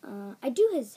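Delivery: ordinary talk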